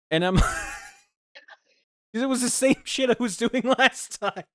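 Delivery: words squeezed out through real laughter, high and loud; cracking up while finishing the story; laughing. Real, not acted. Telling friends the punchline, breaking into laughter.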